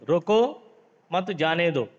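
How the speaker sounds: रोको alone, short pause, then मत जाने दो together; pause after रोको. Shown as a demonstration. Teacher dictating the comma example, pause after रोको. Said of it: "रोको के पीछे मैंने अल्पविराम लगा दिया".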